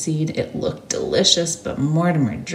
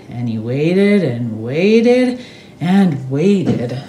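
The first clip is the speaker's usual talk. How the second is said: stretched, slow, patient; slow drawn out; 3 dB louder, much slower than usual. A narrator's reading. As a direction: draw out each waited. Drawn out waiting.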